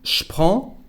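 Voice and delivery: "je prends" said sh-pran; je becomes sh. Shown as a demonstration. Teacher showing the first contraction, "je prends" said as sh-pran. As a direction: put sh straight onto "prends". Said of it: "The first one".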